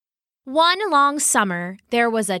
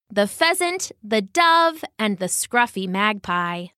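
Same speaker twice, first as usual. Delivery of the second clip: ordinary delivery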